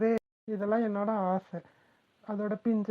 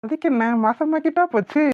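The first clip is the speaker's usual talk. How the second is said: cheeky tease; 5 semitones higher, 8 dB louder than usual. Acted. Husband teasing wife.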